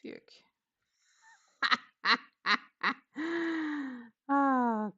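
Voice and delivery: ordinary delivery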